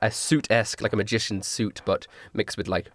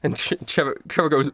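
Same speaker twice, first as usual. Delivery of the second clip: normal talking voice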